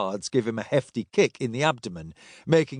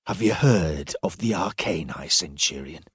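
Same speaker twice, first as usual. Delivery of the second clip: low, controlled threat; 7 semitones lower than usual. A narrator's reading. Silas revealing his secret role to the centurion, low menace. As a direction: drop low, close to his face.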